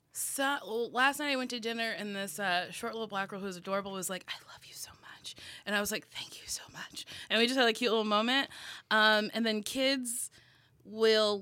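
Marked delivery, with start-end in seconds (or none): 4.3-5.4 voiceless, very soft whisper; whispered adoration. Retold or imitated. Retells little girl's declaration. 6.1-7.1 retold voiceless, intimate whisper; whispered thanks. Her whispered answer to girl.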